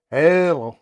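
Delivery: 2 a loud, drawn-out hello into the mic; 3 call into the mic like testing a radio; 1 loud mic check. Real, not acted. 4 keying the CB mic to check watts, loud call.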